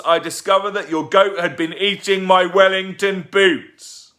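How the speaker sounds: furious rant. Acted. Tugg ranting.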